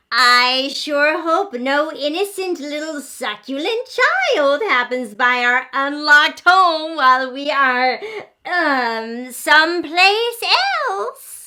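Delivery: a sly dinosaur mom hoping for a meal. Acted. Mama Dinosaur plots sweetly.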